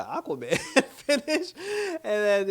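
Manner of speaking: high and laughing mid-phrase. Real, not acted. Reacting to the Aquaman finish, laughing high in disbelief. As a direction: crack up as you say it.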